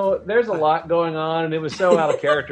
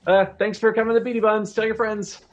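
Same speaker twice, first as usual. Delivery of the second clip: mouseling baker; 9 semitones higher, faster than usual. Acted. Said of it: "he's like".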